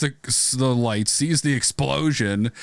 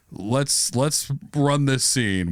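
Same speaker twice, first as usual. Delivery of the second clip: normal talking voice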